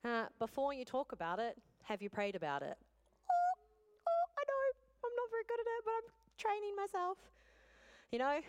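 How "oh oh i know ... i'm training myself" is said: squeaked up high, half laughing at herself; a self-mocking little squeak; high squeaky aside. Real, not acted. Woman admitting to the congregation she's bad at praying, squeaky aside.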